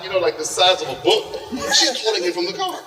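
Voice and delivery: ordinary talk, nothing marked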